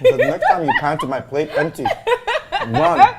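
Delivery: loud, laughing playful warning; laughing threat. Real, not acted. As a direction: laugh while warning her off. Jokey threat while laughing.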